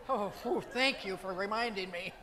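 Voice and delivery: loud, emphatic burst of relief; relieved thanks. Acted. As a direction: blurt out a big grateful "oh". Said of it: "and say".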